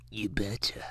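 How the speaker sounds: a soft whispered bot line; whispered NPC catchphrase. Retold or imitated. Mocking TikTok NPC streamers, a whispered bot voice. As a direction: breathe it like a hollow game character.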